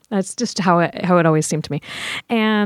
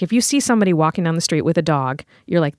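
usual speaking voice

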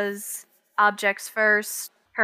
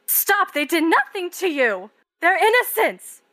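shouted high and pleading; desperate cry; 8 semitones higher, 4 dB louder than usual. Acted. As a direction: cry out to stop the killing. Vision voice protesting the killing.

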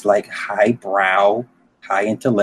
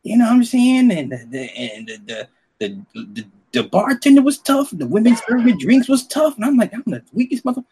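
raised pitch, nervous and laughing; high scared voice; 10 semitones higher than usual. Retold or imitated. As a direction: play it up, frightened. Retelling being scared in club.

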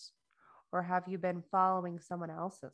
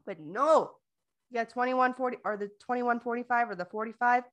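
loud mock scolding; 5 semitones higher, 8 dB louder than usual. Loud, comic retort.

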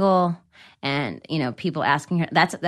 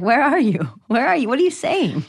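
higher baffled, bewildered asking; 6 semitones higher than usual. Retold or imitated. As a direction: call out as if she's lost. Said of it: "You're like".

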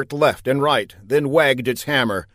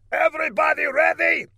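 calling out. Acted. Villain calling out.

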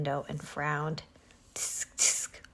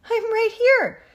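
high, loud answering cry; snorting horse; 9 dB louder, slower than usual. A narrator's reading. Horse answers Henry's call, snorting. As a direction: snort it back loudly. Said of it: "snorted Mister Brown".

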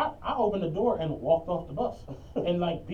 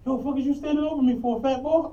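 mock angry man; 6 semitones higher, 5 dB louder than usual. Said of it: "He like".

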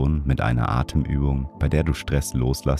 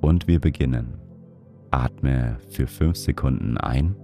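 guided meditation; 3 dB quieter than usual. Acted. Leads a breathing meditation.

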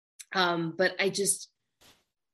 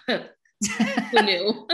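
laughing; 4 semitones higher than usual. Real, not acted. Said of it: "laughs after compliment".

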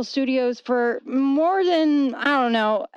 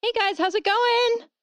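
bright greeting; 11 semitones higher than usual. Shown as a demonstration. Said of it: "Oh, okay".

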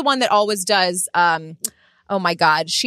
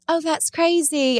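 put-on higher, drawn-out British accent; 6 semitones higher, slower than usual. Imitating a pop star's odd British moments, British accent. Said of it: "talking in a British accent".

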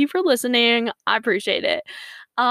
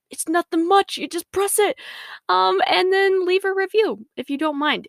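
a much higher, put-on playful voice; silly different voice; 9 semitones higher than usual. Host coaxing listeners to subscribe, in a high playful voice. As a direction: play it light and sing-song, higher up. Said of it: "I use different voices".

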